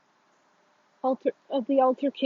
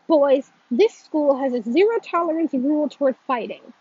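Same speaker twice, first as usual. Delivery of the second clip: firm, louder reproach with a tinge of disappointment; louder, mildly scolding school principal; reproachful principal; 3 semitones higher, 7 dB louder than usual. A narrator's reading. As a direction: lay down the rule to the boys. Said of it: "with a tinge of reproach in her voice".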